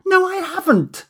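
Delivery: a shrill furious scream at him. A narrator's reading. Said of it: "she screamed".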